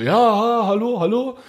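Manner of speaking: put-on high, puzzled old man on the phone; confused old man. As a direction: play a bewildered old man answering. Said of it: "Und dann er immer so".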